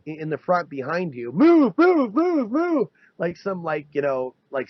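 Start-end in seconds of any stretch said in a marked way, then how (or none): 1.3-2.9 loud, high barked command; mock police yelling move; police shout. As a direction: bark it like an officer clearing a path. Police clearing the way.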